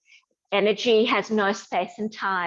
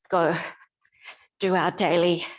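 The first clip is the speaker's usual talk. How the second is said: ordinary delivery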